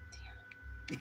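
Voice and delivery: a breathless whispered "Damn." under the breath; whispered curse. Real, not acted. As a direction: let "Damn." slip out as a whisper. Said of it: "You guys heard me?".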